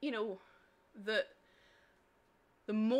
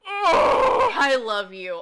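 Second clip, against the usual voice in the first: overjoyed cry; 15 dB louder, much slower than usual. Real, not acted. Moved reading viewer's comment.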